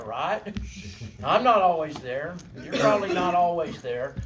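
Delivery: normal talking voice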